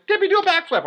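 high, bright call up the ramp; coach calling out. Retold or imitated. Camp coach urging him into a backflip, high call. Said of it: "It's like".

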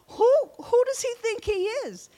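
put-on high, loud incredulous boy's voice; shocked young kid. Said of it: "Here's this young kid saying".

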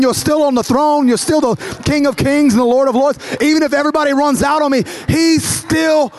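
rising preacher's cadence, the congregation with him; preaching at full force. Acted. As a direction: preach it, lifting every "you're still God".

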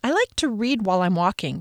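ordinary delivery, nothing marked

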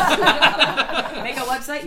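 high, slow laughing joke. Real, not acted. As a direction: laugh through the words. Joking, laughing while speaking.

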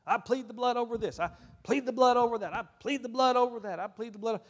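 high, repetitive mock praying; imitating habitual pleading. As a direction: chant it like a rote formula. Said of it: "where we pleaded the blood over everything".

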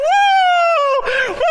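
loud high whoop; joyful woo shout. Shown as a demonstration. Pastor showing the church how to shout, big whoop. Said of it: "You go outside and go".

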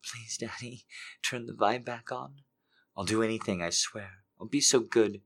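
soft, breathy, pleading whisper-voice; soft desperate begging. Acted. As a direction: plead softly, hungry and desperate. Character begs in hushed voice.

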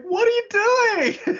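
very high, drawn-out squeal; stretched, squealing mock exasperation; laughing disbelief. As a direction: cry out incredulously while cracking up. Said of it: "I'm laughing so hard".

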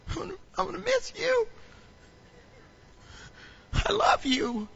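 high, hammed-up weepy farewell; mock tearful goodbye. Pastor bidding his trash farewell, mock crying. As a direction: pretend to cry over the trash bag. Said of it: "I don't cry".

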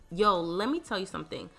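normal talking voice

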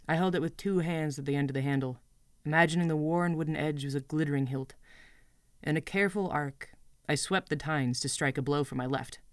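ordinary delivery

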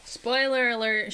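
loud, drawn-out mock announcement; announced warning. Host warns listeners about the Wednesday show, announced loudly. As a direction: call it out like a slow public warning.

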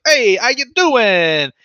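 mock Italian tough guy; fake Italian accent. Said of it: "a fake Italian accent".

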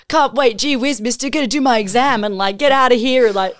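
put-on high, eager golly-gee kid voice; wholesome 1950s kid. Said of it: "he's like".

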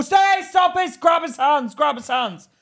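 high, loud urgent shouting; retold panicked commands; frantic orders. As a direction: yell for someone to step in now. Retells shouting orders in car.

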